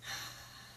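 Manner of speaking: long unvoiced exhale; deep breath out. Real, not acted. Teacher exhales with the class after the exercise. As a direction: let the breath go slowly. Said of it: "take a nice big breath".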